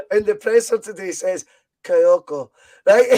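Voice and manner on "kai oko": put-on Ange's Australian accent, low and drawn into KAI-OH-KO; mad Australian accent. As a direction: imitate a mad Australian way of saying Kyogo. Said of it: "he says it in this mad accent".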